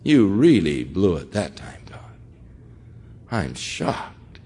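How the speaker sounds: hushed mock scolding, breath only; mock whisper. Preacher mocking a believer scolding God, whispered.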